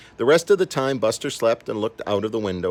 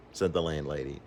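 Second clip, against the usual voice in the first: usual speaking voice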